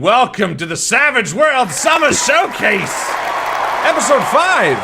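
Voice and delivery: big, high, loud ringmaster intro, stretched out; show announcer. Acted. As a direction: announce the show to a roaring crowd.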